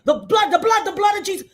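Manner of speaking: high, urgent repeated cry; retold frantic prayer chant; fervent invocation. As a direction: plead it aloud like a preacher. Retold shouted prayer on plane.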